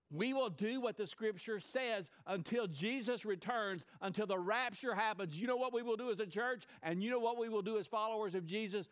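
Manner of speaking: high, loud pulpit challenge; rally the church with rising fervor; fervent sermon climax; full-force preaching. Acted.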